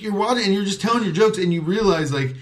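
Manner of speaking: usual speaking voice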